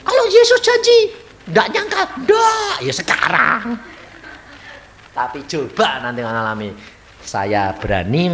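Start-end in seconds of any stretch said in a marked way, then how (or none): 0.1-3.7 much higher, shouted smug boast; mock a comfortable churchgoer bragging; mock congregant. Preacher mocking a congregant boasting while sitting safely, high shout.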